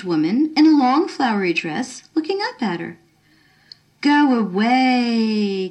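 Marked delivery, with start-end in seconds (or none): 4.0-5.7 slow, tense warning through the teeth; hissed. Acted. Girl on the bridge hissing at the stranger to leave. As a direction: drag it out, pushing her away. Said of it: "Carolyn hissed".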